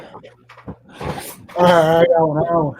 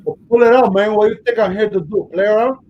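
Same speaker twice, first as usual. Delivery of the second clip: put-on louder, quick Cuban-accented impatience; Tony Montana; 3 semitones higher, 4 dB louder than usual. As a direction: push him like a gangster boss. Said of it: "Tony Montana meets Forrest Gump".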